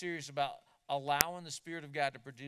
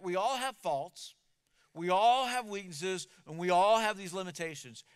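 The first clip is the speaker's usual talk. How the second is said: we all have faults, ALL hit louder each time; stress on all; 8 dB louder than usual. Real, not acted. Preacher hammering the word all to the congregation. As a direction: lean on ALL, building louder.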